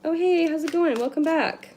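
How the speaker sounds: high, loud cheerful hello; excited greeting. Real, not acted. Greeting a newcomer on stream.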